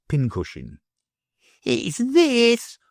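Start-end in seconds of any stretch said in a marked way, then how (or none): 1.6-2.8 high, triumphant bad boy; exultant cry. A narrator's reading. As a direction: crow it out in triumph. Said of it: "cried the bad boy, exultingly".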